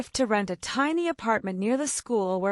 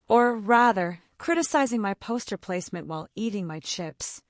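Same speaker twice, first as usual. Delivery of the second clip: ordinary delivery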